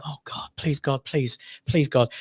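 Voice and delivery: soft, slowing plea; quiet desperate prayer. Retold or imitated. Father pleads while doctors revive his baby, hushed. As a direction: pray under your breath. Father praying during baby's resuscitation.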